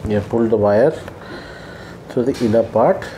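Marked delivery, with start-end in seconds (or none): none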